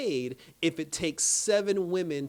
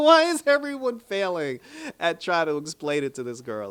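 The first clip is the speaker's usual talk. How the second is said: loud, laughing disbelief at their failing; raised voice, comically fed up; exasperated; 4 semitones higher, 7 dB louder than usual. Retold or imitated. Viewer baffled that everyone fails to explain it, exasperated.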